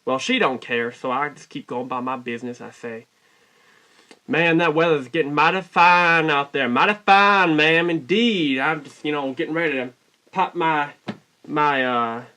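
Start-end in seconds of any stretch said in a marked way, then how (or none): 4.3-8.6 loud, hearty southern drawl, stretched at the end. Retold or imitated. Customer chatting to cashier about weather, hammed-up southern drawl. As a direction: lay the southern charm on thick. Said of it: "my best southern drawl".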